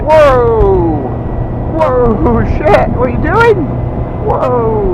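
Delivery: playful startled whoa, rising high; mock surprise. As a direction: act startled by the edit. Reacting to his transition.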